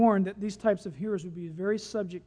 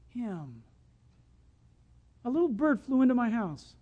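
usual speaking voice

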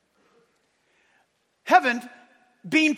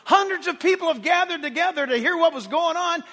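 usual speaking voice

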